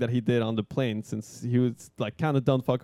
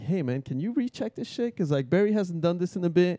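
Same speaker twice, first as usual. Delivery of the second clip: higher-pitched mock-anxious request; worried plea; 6 semitones higher than usual. Nervous student to tandem instructor, higher worried plea.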